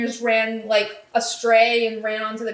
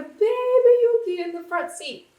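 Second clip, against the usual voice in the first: pitched up high, cooing; 12 semitones higher than usual. Real, not acted. Podcaster fawning over baby Yuki in the truck, high and cutesy. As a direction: coo it in a high little voice.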